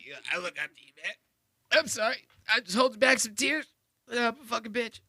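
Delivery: high, mock-weepy whine; mock teary. Mocking the guest as near tears, high mock sob voice. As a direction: fake holding back tears, voice up high. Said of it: "Sound like you're gonna cry a little bit".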